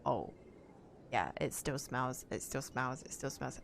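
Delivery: low, soft, repetitive mock male voice; imitating a dismissive boyfriend. Streamer retelling a boyfriend's remarks to his girlfriend, low imitation. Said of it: "He was just like".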